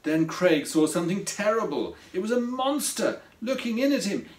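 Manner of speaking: usual speaking voice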